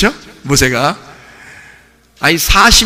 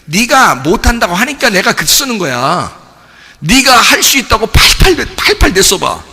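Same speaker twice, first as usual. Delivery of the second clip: raised, higher-pitched scolding; retold rebuke, voice climbing as it goes; angry God; 5 semitones higher than usual. God telling off reluctant Moses, angry. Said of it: "하나님이 화를 내는 거예요".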